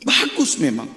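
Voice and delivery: normal talking voice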